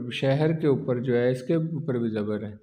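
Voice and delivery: ordinary delivery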